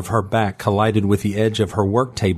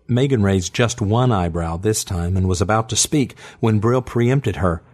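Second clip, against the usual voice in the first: plain reading voice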